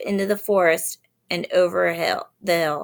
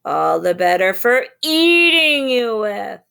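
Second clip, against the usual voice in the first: menacing wolf; 3 semitones higher, 6 dB louder than usual. A narrator's reading. Wolf reveals itself.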